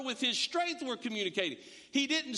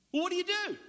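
higher, pressing mock question; exasperated questioner; 9 semitones higher, faster than usual. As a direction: push the question up in disbelief. Acting out questioning a churchgoer.

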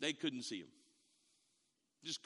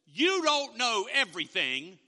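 mother's retort; 5 semitones higher, 9 dB louder, slower than usual. Retold or imitated. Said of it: "And my mother says".

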